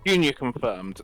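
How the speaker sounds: ordinary delivery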